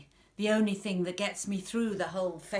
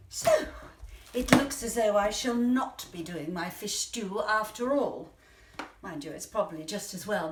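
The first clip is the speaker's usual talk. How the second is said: ordinary talk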